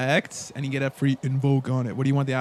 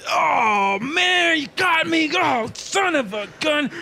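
much higher, shouted cry of a busted tail man; caught sneak; 11 semitones higher, much slower than usual. Acted. The busted tail guy wails at the party, high frustrated shout. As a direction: howl in frustration at being caught, slow on the last line. GM voices caught NPC Komodo.

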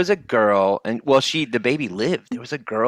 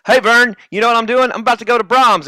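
high, loud pitchman chumminess; imitated loud, high-pitched goofball; Ernest; 11 semitones higher, 6 dB louder than usual. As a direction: holler it excitedly to a buddy through a window. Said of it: "and go like".

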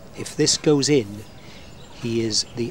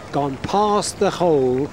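ordinary talk, nothing marked

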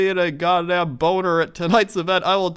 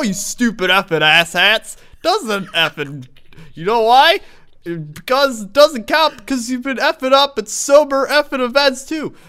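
usual speaking voice

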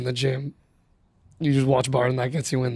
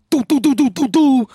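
DJ horn imitation; 11 semitones higher, 5 dB louder than usual. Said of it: "sounded like a goat just got kicked".

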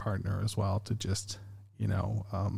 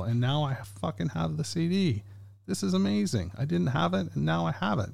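thrilled; 6 semitones higher than usual. Real, not acted. Host thanking fans for a CD, excited. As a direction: pitch jumps higher, delighted and giddy.

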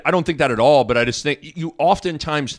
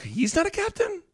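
surprised; 7 dB quieter than usual. Retold or imitated. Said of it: "it was kinda like".

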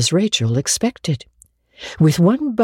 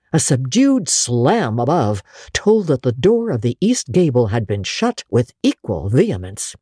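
usual speaking voice

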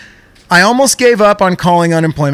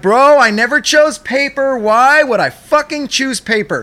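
incredulous protest; 8 semitones higher than usual. Retold or imitated. Caller protesting to agent.

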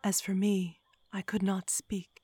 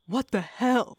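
higher, louder startled cry; startled yelp; 5 semitones higher, 8 dB louder than usual. A narrator's reading. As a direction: yelp in shock. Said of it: "the killer yelped".

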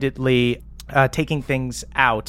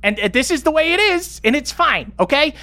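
much higher, tumbling defensive explanation; flustered Stella; 11 semitones higher than usual. Acted. Stella justifying the crew deal to accusing players, flustered and high. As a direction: squeak out the excuses.

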